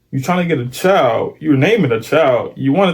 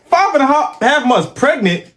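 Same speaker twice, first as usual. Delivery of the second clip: higher, incredulous repeating of the news; stunned disbelief; 10 semitones higher than usual. Real, not acted. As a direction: repeat it like you can't believe your ears. Streamer stunned by the reveal.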